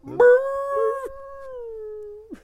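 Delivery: high, drawn-out mock howl fading away; fading beagle howl. Beagle dropped from a plane, howl fading off. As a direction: howl like a dog falling from a plane. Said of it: "That's the noise you'd hear".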